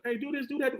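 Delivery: soft-spoken coach giving orders without yelling; Steve Spurrier. Retold or imitated. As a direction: hand out instructions very quietly. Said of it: "He don't do a lot of yelling".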